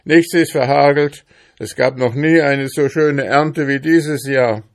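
high, loud contradiction; heated, boastful outburst; shouting. A narrator's reading. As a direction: shout the denial emphatically. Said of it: "schrie Ambrosius Dauerspeck".

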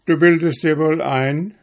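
boastful little hedgehog, pitched high and loud. Acted. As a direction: puff himself up, challenging the hare. Narrator voices the hedgehog. (gereizter Igel, hoch und laut)

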